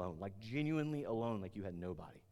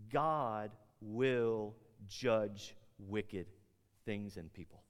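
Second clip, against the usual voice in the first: very slow, word by word; solemn declaration; much slower than usual. Real, not acted. Preacher declaring a hard truth to the congregation, solemn and emphatic. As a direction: weigh each word, drawn out and deliberate.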